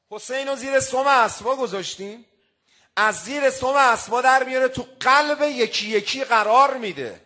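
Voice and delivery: rising to a loud, fervent peak; preaching climax. Real, not acted. Preacher stirring the mourners, loud and fervent. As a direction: lift the crowd with mounting force.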